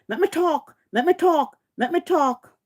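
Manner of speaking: high sing-song mock of a rival; mocking pleading. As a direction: parody her begging to be heard. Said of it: "you come on".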